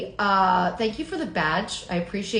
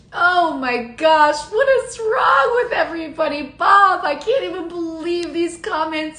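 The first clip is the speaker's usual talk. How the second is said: exasperated disbelief; 6 semitones higher, 5 dB louder than usual. Real, not acted. Exasperated at chat comments.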